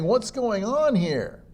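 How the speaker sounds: slow, high puzzled voice; confused listener. Retold or imitated. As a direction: sound baffled. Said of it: "people are saying".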